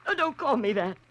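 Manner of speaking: backing away from the truth, voice climbing; frightened denial. Acted. Ruth denies Holliday knows her secret, frightened and pleading.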